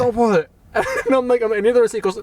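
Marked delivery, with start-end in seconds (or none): 0.0-0.5 higher-pitched imitated warning; in-your-face mock anger. Attendant warning customer off the receipt, mock anger. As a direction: mimic the irate attendant's refrain. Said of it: "he fucking gets in my face".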